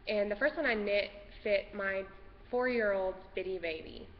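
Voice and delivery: normal talking voice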